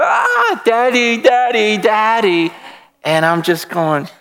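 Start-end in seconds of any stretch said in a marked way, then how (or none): 0.0-2.5 put-on high squealing little boy crying "daddy"; excited child. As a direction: shriek with a delighted toddler's glee. Child squealing while being thrown.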